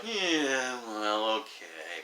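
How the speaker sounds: slow, drawn-out grudging concession; mock reluctance. Homophobic guys half conceding his point, mock reluctant. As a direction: drag it out as if unconvinced.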